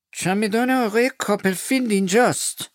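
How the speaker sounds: high cold formal voice; stern announcement. Acted. Miss Murdstone coldly announces the trunk has arrived.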